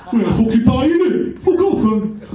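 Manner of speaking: broad northern voice, laughing; mock Wigan accent. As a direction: ham up a rough northern lad. Mocking a Wigan local.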